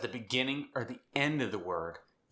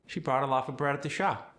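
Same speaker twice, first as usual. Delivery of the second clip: South African accent; 4 semitones higher, faster than usual. Shown as a demonstration. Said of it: "The South African accent".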